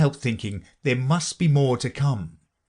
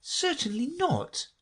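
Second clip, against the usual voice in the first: high, soft, indignant refusal; Alice; 6 dB quieter, slower than usual. A narrator's reading. Alice denies learning washing, indignant high voice. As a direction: deny it primly and slowly. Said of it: "said Alice indignantly".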